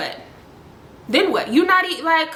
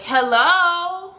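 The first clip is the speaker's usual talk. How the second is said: higher-pitched mock indignant greeting; indignant hello; 7 semitones higher than usual. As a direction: imitate an affronted caller, pitched up. Acted girl's indignant response on call.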